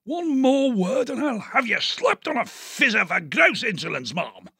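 high, blustering colonel with a shouted start; outraged old officer. Acted. Diggs berating the sister, as an outraged colonel. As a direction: bellow like an insulted officer. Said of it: "He was outraged".

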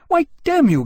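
high, loud outburst; furious challenge. Acted. Jane interrupting Gambier, hand to sword, furious. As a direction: flare up and cut him off.

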